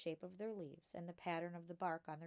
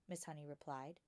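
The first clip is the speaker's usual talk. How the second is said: plain reading voice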